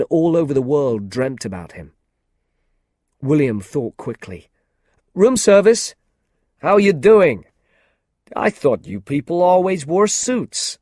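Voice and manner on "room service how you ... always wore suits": film star's breezy friendly voice, higher and louder. A narrator's reading. Famous actor Jeff breezily greeting William at the door, friendly. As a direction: greet the stranger cheerfully. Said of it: "Jeff said in a friendly way".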